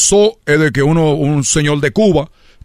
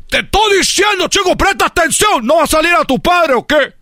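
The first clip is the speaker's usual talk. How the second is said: very high shouted scolding; hammed-up furious shout; father yelling; 13 semitones higher than usual. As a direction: scream at the clumsy son.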